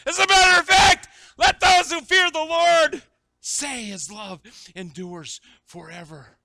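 amped summons, slowing and settling; shout dying down. Acted. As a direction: start hollering, then ease right down on the last words.

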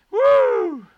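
high whooping cry; excited whoop. Real, not acted. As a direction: let out a joyful woo. Preacher exclaims mid-sermon.

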